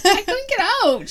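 high, loud whiny wail, imitating her child self; mock sobbing. Sleepwalking girl bawling to her sister that she couldn't get out. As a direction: plead like a bawling kid stuck in a room. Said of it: "And I was like".

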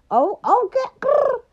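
high playful "Oh, okay" then a growl. Pretend. Host to listeners, a high playful growl. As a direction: tease it out, then growl like a cat.

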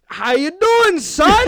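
high, loud goofy dad voice; a clueless dad covering his blunder; awkward stage dad. Acted. Comic voicing his scene partner as the dad, high and loud.

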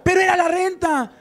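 high, loud cry of a dismayed wife; mock wife protesting about the rent. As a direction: wail it like a wife who lost the rent. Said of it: "Y luego la esposa".

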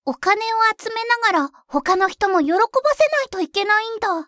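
normal talking voice

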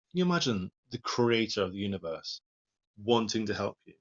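ordinary delivery

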